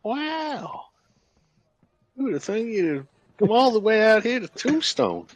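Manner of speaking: ordinary delivery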